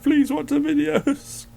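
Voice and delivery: high, slow pleading squeak; squeaky drawn-out plea; mock plea. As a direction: beg sweetly in a high voice. Playful plea to subscribers.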